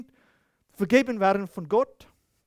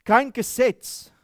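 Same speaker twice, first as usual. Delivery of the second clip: "Kein Gesetz" slow and loud, stress on "Kein"; preacher's slow, loud "Kein Gesetz"; emphatic repeat; 3 semitones higher, 4 dB louder, slower than usual. Shown as a demonstration. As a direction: hammer "Kein" home slowly. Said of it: "Gegen solche Dinge gibt es kein Gesetz".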